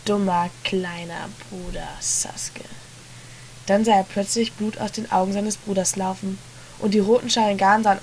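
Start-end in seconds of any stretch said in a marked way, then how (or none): 0.0-2.6 cold whisper. A narrator's reading. Brother answers coldly.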